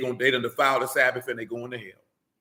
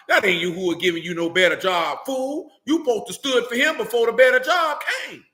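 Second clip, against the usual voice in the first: loud, high-pitched scolding building to a shout; heated rebuke; 6 semitones higher, 4 dB louder than usual. Real, not acted. Preacher rebuking those awaiting a better job, heated rebuke. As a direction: raise pitch and volume as you scold.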